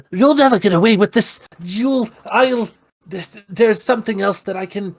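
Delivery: high, fast defiant goblin; goblin villain. Acted. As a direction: squeal it out like a cornered villain. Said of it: "he just says".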